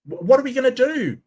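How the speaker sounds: fast fake terror over colliding atoms; sarcastic mock doom; mock panic. Mocking doomsday claim.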